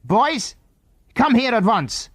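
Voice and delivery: high shout into the night. A narrator's reading. Man shouts into the night for the missing boys, high and sharp. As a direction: call them sharply from far off. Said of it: "shouted into the night".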